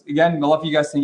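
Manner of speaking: ordinary delivery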